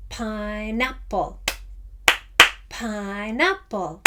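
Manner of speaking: pineapple spoken slowly in three beats, PINE-ap-ple; slow rhythmic pineapple. Shown as a demonstration. Teacher saying pineapple slowly so kids can clap. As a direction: spell out the beat with your voice. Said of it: "when we say it".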